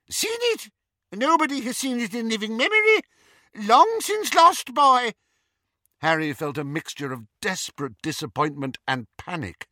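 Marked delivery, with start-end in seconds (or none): 0.1-5.1 high squeaky incredulous wizard. A narrator's reading. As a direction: squeak it back in disbelief. Flitwick answers scornfully.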